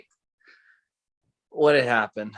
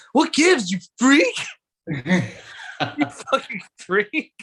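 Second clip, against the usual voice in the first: mock taunt; 10 semitones higher, much slower than usual. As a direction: jeer at the kid like a bully.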